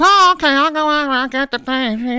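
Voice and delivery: put-on very high, eager dim old woman; high chirpy fluster; clueless clerk. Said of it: "And she goes".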